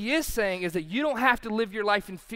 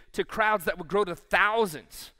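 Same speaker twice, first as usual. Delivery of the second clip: ordinary talk, nothing marked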